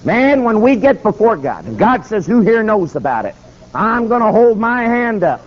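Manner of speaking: high-pitched, insistent preacher; righteous challenge. Retold or imitated. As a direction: testify against him with conviction. Preacher keeps confronting the man.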